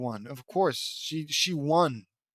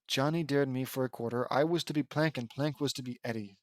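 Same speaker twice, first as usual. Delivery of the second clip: softer, toned-down delivery; subdued reread; 3 semitones lower, 5 dB quieter than usual. Real, not acted. Reader repeats Johnny's dare line, subdued and softer. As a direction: drop the act and read it through quietly.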